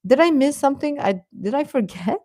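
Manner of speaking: usual speaking voice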